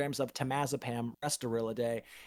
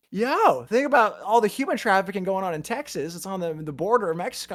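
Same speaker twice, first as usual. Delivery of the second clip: raised, worked-up retelling; pitched up, pressing the point; urgent alarm; 6 semitones higher, 3 dB louder than usual. Retold or imitated. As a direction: re-enact your own urgent realization. Retold urgent alarm about trafficking.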